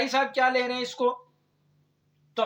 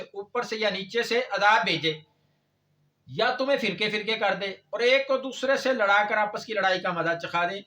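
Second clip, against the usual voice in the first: ordinary delivery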